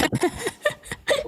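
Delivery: high breathy burst of laughter; high giggle. Real, not acted. Laughing at her own slip, high giggle.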